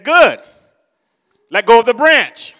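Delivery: loud, firm divine command; voice of God. Retold or imitated. The Lord instructing the man on the cliff, commanding. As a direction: order it with full authority. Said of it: "The voice said".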